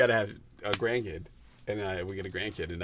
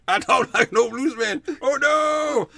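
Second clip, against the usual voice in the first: put-on loud, high-pitched old bluesman act; old blues man; 9 dB louder, slower than usual. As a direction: wail it like a hammed-up blues elder. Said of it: "Do it again".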